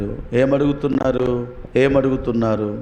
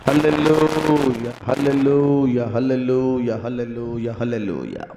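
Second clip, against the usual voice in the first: fading hallelujahs. Acted. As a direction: let the praise die away to a hush. Leads congregation in hallelujahs. (క్రమంగా తగ్గుతున్న హల్లెలూయా)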